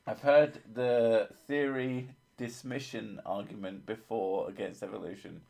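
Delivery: usual speaking voice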